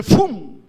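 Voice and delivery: loud, high imitated "fum" whoosh; whoosh sound effect. Preacher imitates the sudden pull to earth's centre, loud whoosh.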